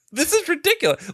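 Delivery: laughing through a high, disbelieving line; laughing disbelief. Retold or imitated. To his dad as the flames rise, laughing in disbelief.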